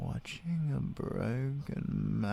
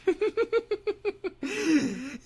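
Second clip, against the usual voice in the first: high villain cackle. Acted. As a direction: cackle in delight at their fate. Villain gloating over captive.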